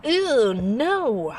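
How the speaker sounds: high, loud recoil of disgust; grossed-out protest. Acted. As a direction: pull back from the very idea. Disgusted at being thought a man-eater.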